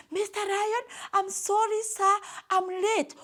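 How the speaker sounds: high-pitched hare pleading with the king; small high voice, begging pardon; timid servant excusing lateness; apologetic hare. A narrator's reading. Hare apologises to hungry lion.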